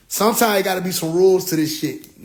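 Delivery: ordinary delivery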